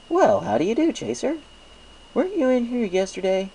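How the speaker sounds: high, friendly shopkeeper pony voice; chirpy baker pleased with business; Mister Cake's voice. A narrator's reading. Baker thanks Applejack for the apples, chirpy and friendly. Mister Cake greets Chaser.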